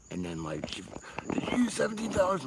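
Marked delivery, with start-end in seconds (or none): 1.3-2.4 high-pitched indignant question; high mock interrogation; mock girlfriend accusation. Acts out girlfriend confronting him.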